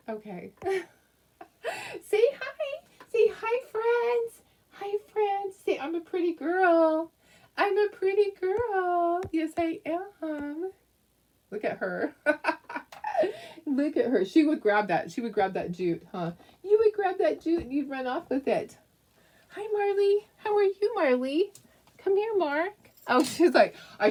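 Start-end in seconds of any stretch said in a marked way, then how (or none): 0.6-5.8 talking to the dog. Real, not acted. Greeting her dog Chloe in high doting baby talk. 5.8-10.7 high slow doting puppy voice; voicing the dog. Putting words in the puppy's mouth, high and slow. 19.5-22.7 talking to the dog. Real, not acted. Greeting her dog Chloe in high doting baby talk.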